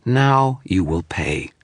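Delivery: slow, sneering threat. A narrator's reading. Image threatening Dresden after calling its demon, slow and sneering. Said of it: "a sneer to its voice".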